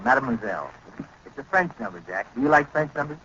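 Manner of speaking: ordinary talk, nothing marked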